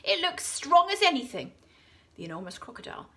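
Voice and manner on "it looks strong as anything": high, loud confident reply; children sure the seesaw is safe. A narrator's reading. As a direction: say it boldly.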